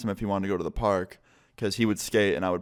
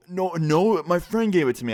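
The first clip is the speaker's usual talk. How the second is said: high, louder flustered protest; panicked denial; 8 semitones higher, 4 dB louder than usual. Retold or imitated. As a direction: scramble to deny it. Caught thief denying panicked.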